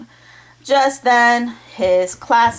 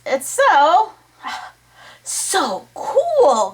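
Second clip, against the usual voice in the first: excited; 7 semitones higher, much slower than usual. A narrator's reading. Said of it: "Carlos yelled".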